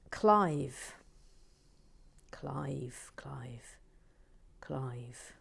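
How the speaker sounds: calls of Clive sinking to a whisper; Clive, whispered away; fading name call. Acted. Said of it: "name fading into whisper".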